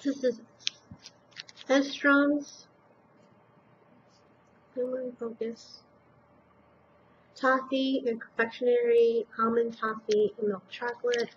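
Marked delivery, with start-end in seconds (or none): 4.7-5.8 muttered under the breath; quietly nudging herself to focus. Real, not acted. Reading a tricky product name.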